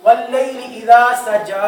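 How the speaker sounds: slow Quran recitation, stopping at "सजा"; recited with a pause. Shown as a demonstration. Teacher demonstrating a pause to students, slow recitation. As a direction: recite slowly and stop cleanly at the end. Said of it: "ये मैं वक्फ कर दिया है".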